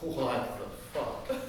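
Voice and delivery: low, slow, stunned; waking baffled from a bad dream; dazed bewilderment. Wakes bewildered from nightmare.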